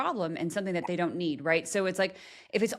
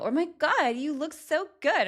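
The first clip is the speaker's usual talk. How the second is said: fake gushing; 9 semitones higher, 3 dB louder than usual. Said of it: "And I'm like".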